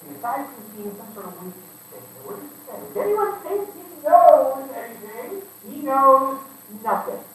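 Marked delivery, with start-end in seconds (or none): 2.8-7.2 slow and loud, knows punched; emphatic reread. Shown as a demonstration. Preacher rereading the verse to the church, knows stressed. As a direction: hammer the word knows.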